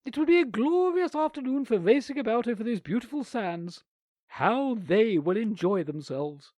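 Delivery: a bossy aunt making the outing sound grand; prim aunt. A narrator's reading. Aunt's voice in story.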